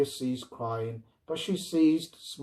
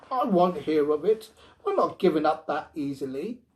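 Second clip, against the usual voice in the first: loud, higher, firm refusal; grim resolve; 9 semitones higher, 9 dB louder than usual. A narrator's reading. Wife refusing to bring the robot back, loud and grim. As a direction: dig in and refuse to give way. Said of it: "she replied grimly".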